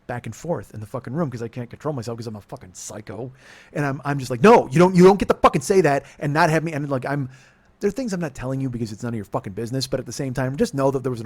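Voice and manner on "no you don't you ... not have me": shouting, much higher and louder; furious yell. Retold or imitated. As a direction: explode at her, yelling. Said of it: "and I start yelling".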